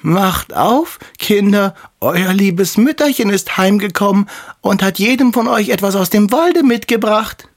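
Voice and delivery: put-on the wolf squeaks sweetly to fool the kids; fine wolf voice. Wolf after eating chalk fakes mother's voice, high and sweet. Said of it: "klopfte an und sprach".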